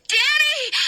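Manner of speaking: high loud cry of his name; calling out. Acted. Hannah spotting Danny behind the flames, loud cry. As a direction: shout as you spot him. Sees him trapped.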